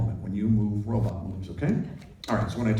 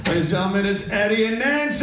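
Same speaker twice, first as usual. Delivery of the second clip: loud high showman call; announcer; 10 semitones higher, 5 dB louder than usual. Acted. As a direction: announce to the crowd. Presenting to the audience.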